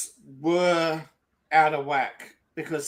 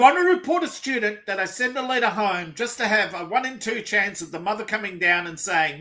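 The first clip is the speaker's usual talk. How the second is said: normal talking voice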